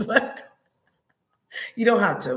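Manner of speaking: ordinary talk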